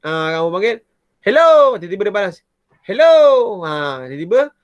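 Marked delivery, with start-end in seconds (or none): 1.2-1.7 loud high call into a cave. Shown as a demonstration. Calling out in a dark cave. 2.8-3.2 loud high hello acting as the echo; echo call. Shown as a demonstration. The cave echoes the call back.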